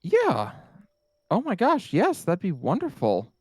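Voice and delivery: high-pitched, gushing excitement; feigned delight. Acted. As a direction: gush as if thrilled, hiding your dread. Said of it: "Even as inside, she's like, "Bleh".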